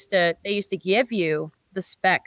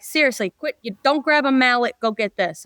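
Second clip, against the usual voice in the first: put-on New York accent; 3 semitones higher than usual. Mechanic telling him to drop the mallet, light New York accent. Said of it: "your New York accent was pretty good".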